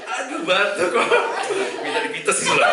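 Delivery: rengekan manja, tinggi dan lambat; anak manja. Retold or imitated. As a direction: tirukan anak yang minta dimanja. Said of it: "Manja gitu ya". (suara manja tinggi dan pelan)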